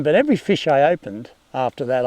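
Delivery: ordinary talk, nothing marked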